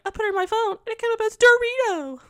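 squeaky high quoted voice; high mock impression. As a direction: pitch way up, imitating her. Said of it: "on camera being like".

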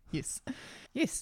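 trying out a New Zealand "Yes", like "yis"; playful New Zealand vowel practice; New Zealand accent. Retold or imitated. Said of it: "replace all your E's with I's".

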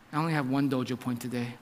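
low, soft confession, imitating the son; quiet child. Son admitting a poor school score, quiet. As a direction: confess it low and small to dad. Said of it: "he was all quiet".

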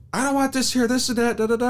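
very high mock complaint; mimicked complaining wife. Husband mimicking his wife about the table, high mock griping. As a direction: imitate a partner griping in a squeaky-high singsong. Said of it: "She's like".

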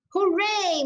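a high, bright hooray; the father cheering the doctor's revenge, high and excited. A narrator's reading.

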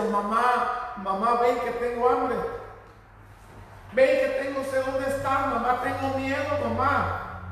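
acted child's cry for help; pleading child. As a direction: beg like a hungry, scared boy. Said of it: "al escuchar que le estaba hablando".

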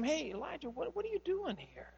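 an angel softly asking a hiding man; gentle angel voice. Retold or imitated. Angel quietly asks the hiding Elijah, soft and high.

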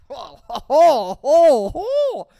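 rising, loud high whoops; exaggerated hooting. Reacting to his promise to call, high excited hooting. Mock swooning after the date's promise.

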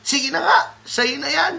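high-pitched huffy mock-child concession; exasperated son. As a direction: imitate a boy grudgingly surrendering his toy. Acted son grudgingly gives up toy.

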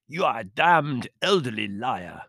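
emphatic indignant manservant; angry insult. A narrator's reading. Servant insulting countess in quarrel, angry. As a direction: come down hard on each insulting word.

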